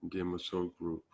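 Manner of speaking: usual speaking voice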